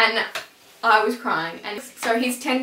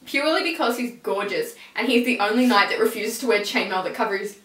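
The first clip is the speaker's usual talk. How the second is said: ordinary talk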